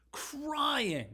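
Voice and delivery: "crying." jumps very high, drawn out; stressed crying. Shown as a demonstration. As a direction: hit "crying." way up high with feeling.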